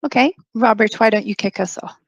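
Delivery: normal talking voice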